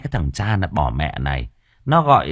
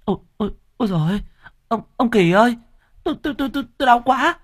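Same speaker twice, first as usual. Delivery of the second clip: high, strained wife in labor pains, much higher; crying out in labor; 3 dB louder, faster than usual. Acted. Pregnant wife crying to her husband, high pained wail. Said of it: "Cô ấy kêu lên".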